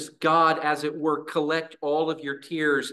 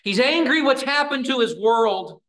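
higher and louder, a burst of righteous anger; angry outburst; 8 semitones higher, 6 dB louder than usual. Real, not acted. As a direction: let the anger rise on this line. Preacher stresses Jesus' anger.

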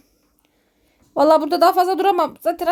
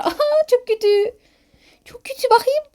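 horrified; 9 semitones higher than usual. Real, not acted. Reacts to burnt bodies below.

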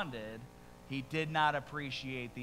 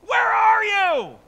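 anguished outcry; 11 semitones higher, 14 dB louder than usual. Acted. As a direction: howl at God for justice. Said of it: "how Habakkuk started this book".

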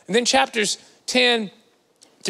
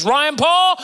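higher, louder mom calling the full name; 8 semitones higher, 4 dB louder than usual. Retold or imitated. Mom calling her son by middle name, stern. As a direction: call him like he's in trouble. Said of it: "When my mom says".

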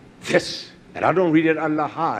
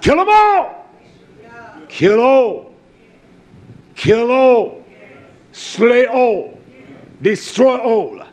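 loud, higher, hammering each kill; thundering command; 6 semitones higher, 7 dB louder, much slower than usual. Acted. Preacher voicing God ordering the slaughter, shouting. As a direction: preach it at full force, one blow per line.

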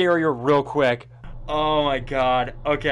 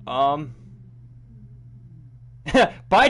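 normal talking voice

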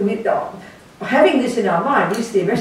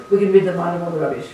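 usual speaking voice

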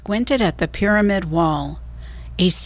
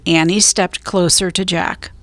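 ordinary delivery, nothing marked

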